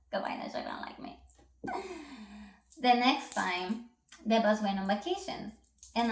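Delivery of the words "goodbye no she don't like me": hushed laughing whisper to the viewers; whispered aside. Real, not acted. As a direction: drop to a secret whisper. Aside after mocking farewell.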